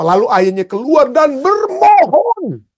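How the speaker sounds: begging like a father near tears; pleading. Shown as a demonstration. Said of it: "harusnya ayat ini katakan apa". (memohon sambil menahan tangis)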